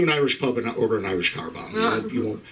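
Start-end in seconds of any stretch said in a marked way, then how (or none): none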